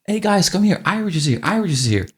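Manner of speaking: imitating shop staff calling everyone over; eager call to coworkers, fading down; excited customers. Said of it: "And they were still excited".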